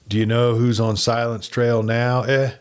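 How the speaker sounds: ordinary delivery, nothing marked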